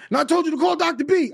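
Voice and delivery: put-on high, loud gruff old man voice; angry old man. [name] mimics Dick Gregory calling back, high and loud. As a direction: bark it like a cranky old man.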